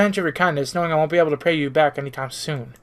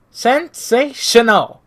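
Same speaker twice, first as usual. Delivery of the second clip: a slow, showy sensational; drawn-out exclamation; 5 semitones higher, 4 dB louder, much slower than usual. Acted. Character hams up praise for the clothes, drawn out. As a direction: stretch out sensational very slowly, louder.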